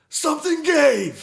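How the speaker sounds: slow, very high, loud yell; mock outburst. Payoff of the game rant to his friend, a slow high shout. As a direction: explode on it like the dam just broke.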